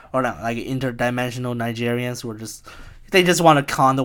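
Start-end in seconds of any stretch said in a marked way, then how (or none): none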